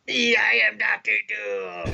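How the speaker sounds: slow booming villain voice; Doctor Doom voice. Retold or imitated. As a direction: proclaim it like a comic book tyrant. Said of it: "talk like".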